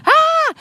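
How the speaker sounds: sudden very high loud scream. Acted. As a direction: blast a scream at them. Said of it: "I almost just scream".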